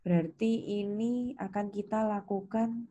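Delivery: ordinary talk, nothing marked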